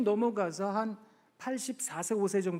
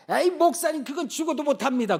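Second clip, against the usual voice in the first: loud refusal; 7 semitones higher, 5 dB louder than usual. Retold or imitated. 할아버지의 거절을 흉내 냄.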